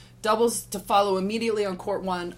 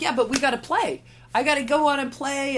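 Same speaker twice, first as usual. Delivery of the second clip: higher, pressing, a bit louder; insistent Steffi; 7 semitones higher, 4 dB louder than usual. Retold or imitated. Steffi insisting to the referee, higher and pushing. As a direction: push to stay in and play.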